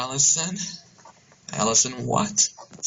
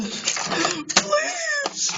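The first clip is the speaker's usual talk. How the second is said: anguished plea. Acted. Grieving over dying Allison.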